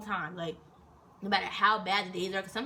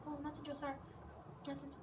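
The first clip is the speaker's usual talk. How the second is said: soft, higher innocent voice; sheepish cover-up; 6 semitones higher, 13 dB quieter than usual. Retold or imitated. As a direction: act like nothing is going on. Caught, answering sergeant innocently.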